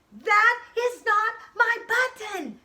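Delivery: loud, high, slow angry shout; shouted angry protest. Acted. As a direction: yell it out in anger. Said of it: "shouted Frog".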